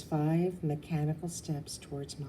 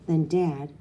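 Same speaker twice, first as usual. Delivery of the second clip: usual speaking voice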